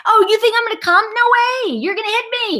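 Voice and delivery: high, quick mock yell; defiant yelling. Child yelling out of the door at dad, mock defiant. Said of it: "I'm yelling out of my door".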